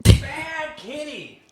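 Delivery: high-pitched, drawn-out pet telling-off; scolding a cat. Real, not acted. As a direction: squeal a slow reprimand at the kitty.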